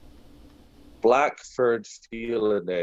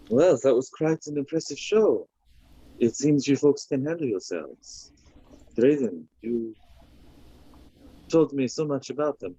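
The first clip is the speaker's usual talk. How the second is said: higher, theatrical character voice; suave, impressed feline monk; 6 semitones higher than usual. Acted. Feline newcomer praises Drayden's companions in a high, theatrical voice. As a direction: pay the compliment grandly, in a raised voice.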